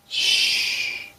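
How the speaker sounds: long, soft hushing sound; shushing. Real, not acted. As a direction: shush them with a drawn-out hiss. Shushing the commenters.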